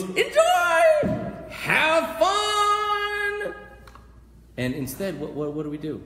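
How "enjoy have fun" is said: very high, loud, stretched-out shout; exuberant calling out. Acted. God sending people into Wonderland to play, exuberant calling out. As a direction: sing it out to the whole world. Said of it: "and he goes".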